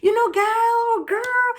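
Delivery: put-on high-pitched, drawn-out girlfriend chat; demonstrating lively, high-voiced banter; animated African talk. Shown as a demonstration. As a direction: call out high and excited to a girlfriend. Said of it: "You know the way we talk".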